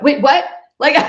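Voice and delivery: high, loud double-take; startled disbelief. Retold or imitated. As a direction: act out being stunned by your own action. Retold shock after hitting send.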